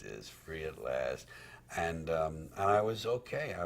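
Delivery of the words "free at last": soft slow echo. Retold or imitated.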